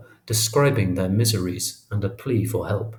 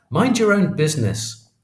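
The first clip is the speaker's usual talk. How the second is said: high, louder, mildly acted scream of fury; furious yelling; 8 semitones higher, 4 dB louder than usual. A narrator's reading. Fourth brother to narrator after his thwarted plan, furious yell. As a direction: voice a raging boy's yell, held short of screaming. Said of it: "screaming at me at the top of his lungs".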